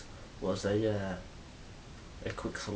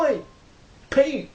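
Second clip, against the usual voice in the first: loud, higher call across a distance; 10 dB louder than usual. Retold or imitated. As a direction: shout to a friend far away. Calling a mate far off.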